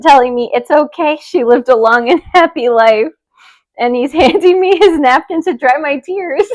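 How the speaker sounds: reading through giggles, pitch climbing; stifled laughter. Real, not acted. Reader losing it over a listener's tearful date story, stifled laughter. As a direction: try to keep reading as laughter breaks in. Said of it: "trying not to laugh".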